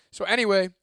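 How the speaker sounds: ordinary delivery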